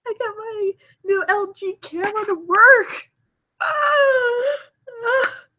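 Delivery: high, elated squeals of relief; real giddy joy, voice leaping up; overjoyed. Real, not acted. Streamer celebrates getting the new camera working, overjoyed. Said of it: "so happy".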